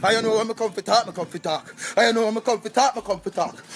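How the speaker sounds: heavy Jamaican patois rant; Jamaican accent. Acted.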